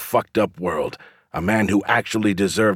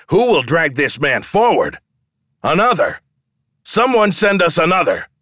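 impassioned crowd call; 5 semitones higher than usual. A narrator's reading. Showman begs the gods for another challenger, calling out.

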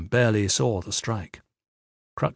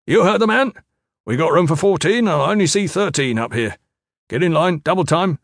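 high, loud drill instructor; barked orders; 7 semitones higher, 5 dB louder than usual. A narrator's reading. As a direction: bark orders down the line. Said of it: "Trainer Fisk barked".

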